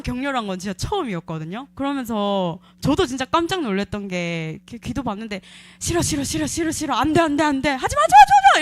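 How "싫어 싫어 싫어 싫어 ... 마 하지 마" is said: frantic screaming refusal, rising to a high, loud shriek; panicked shrieking refusal, climbing to full volume; retold scream. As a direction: scream no over and over as if fighting off hands. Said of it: "내가 지를 수 있는 최고의 소리 지름으로".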